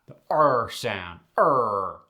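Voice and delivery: slow, firm "the R sound, er". Shown as a demonstration. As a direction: demonstrate a hard, tight Midwest "er". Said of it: "That's right".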